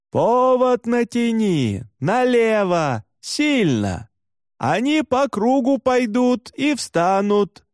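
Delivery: high, slow, stretched-out calls; distant shouted reply. A narrator's reading. Herder answers novice driver through wind, calling out. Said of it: "Сквозь шум ветра донёсся ответ".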